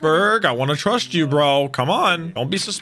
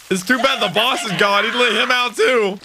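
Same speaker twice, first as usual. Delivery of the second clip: ordinary talk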